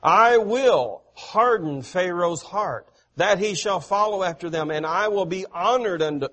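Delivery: loud, declaiming preacher voice, easing off at the end; forceful scripture reading. Acted. Preacher reading God's words to the congregation, loud and declaiming. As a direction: proclaim God's words from the pulpit. Reads Pharaoh verse with force.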